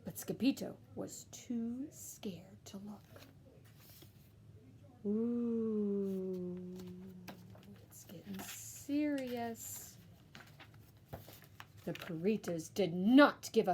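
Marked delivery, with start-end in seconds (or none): 0.0-10.0 soft, slow whisper; slow down and hush; whispered suspense aside. Acted.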